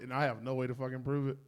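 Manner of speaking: normal talking voice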